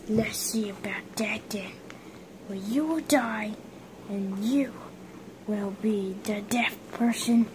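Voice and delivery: whispered villain threat; quiet menacing whisper; sneaky whisper. Pretend. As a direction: hiss the threat under your breath.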